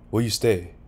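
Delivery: usual speaking voice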